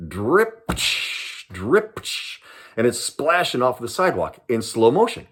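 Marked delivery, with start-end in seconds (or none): 0.1-2.0 dripping sound. Retold or imitated. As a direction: imitate drops hitting the sidewalk in slow motion. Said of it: "I'm hearing the noise".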